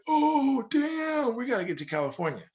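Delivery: high, fast mock excitement; excited gold rush cry. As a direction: play the whole country catching gold fever. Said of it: "Everybody's like".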